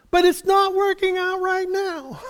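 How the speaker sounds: high put-on voice of a distressed woman; mimicked upset reply. Preacher voicing Mary's upset answer to comforters. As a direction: squeak out the protest as the grieving Mary.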